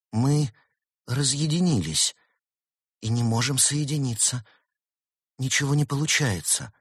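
high-pitched little fox voice; upset child-like figure, voiced high; distressed little fox. A narrator's reading. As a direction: report helplessly in a small high voice. Fox girl answers, distressed, for everyone.

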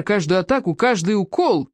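plain reading voice

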